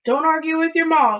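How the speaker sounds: father's sharp reprimand; stern order. A narrator's reading. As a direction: lay down the law loudly. Father scolds child.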